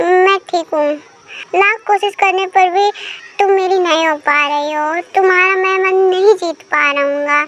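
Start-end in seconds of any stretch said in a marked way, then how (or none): none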